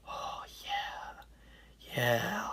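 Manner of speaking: imitating him in a breathy whisper; hushed awed reaction. Kirk reacting to the preacher's big voice, in a mock hushed whisper. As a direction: whisper it very softly, as if quietly won over.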